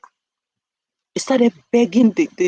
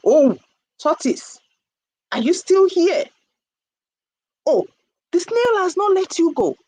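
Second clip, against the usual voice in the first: surprised villagers; 8 semitones higher than usual. A narrator's reading. Returning villagers to the trapped tortoise, high-pitched surprise. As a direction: exclaim at finding him still stuck.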